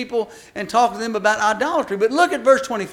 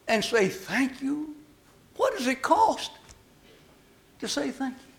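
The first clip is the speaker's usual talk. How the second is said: hushed; 3 semitones higher, 6 dB quieter than usual. Real, not acted. Quiet after loud rebuke.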